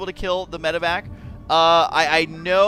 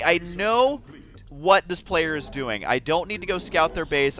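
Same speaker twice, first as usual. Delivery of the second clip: ordinary delivery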